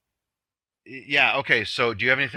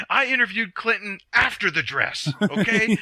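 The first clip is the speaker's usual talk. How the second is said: ranting anchor; 8 semitones higher than usual. Retold or imitated.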